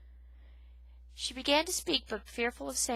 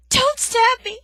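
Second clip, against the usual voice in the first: high, loud panicked plea; a frightened mouse begging for her life; terrified cry; 16 dB louder, slower than usual. Acted. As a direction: scream it in terror. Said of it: "Poppy cried through chattering teeth".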